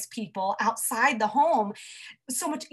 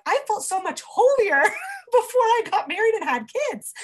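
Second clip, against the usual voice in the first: voiced high and louder, laughing through it; laughing mom quote; 10 semitones higher, 5 dB louder than usual. Mom admits holier before kids, laughing. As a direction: play a mom laughing at herself. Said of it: "share that feeling of, like".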